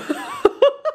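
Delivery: giddy laugh at the surprise gifts; high squealing laugh. Real, not acted.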